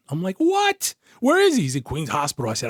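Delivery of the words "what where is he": very high, incredulous yelp; alarmed question, voice shooting up; re-enacted shock, pitched way up; shocked reaction. Reacting to suicide attempt news.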